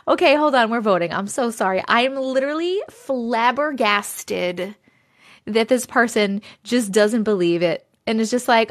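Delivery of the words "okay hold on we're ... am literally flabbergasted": usual speaking voice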